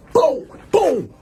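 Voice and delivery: high, loud punchy "boom, boom"; imitated punch impacts. Storyteller reenacts his punches landing, loud high booms. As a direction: sound out each blow landing.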